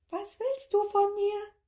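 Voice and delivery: tiny creature mimicking the plea; squeaky echo. A narrator's reading. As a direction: squeak it back at him. Creature echoes him.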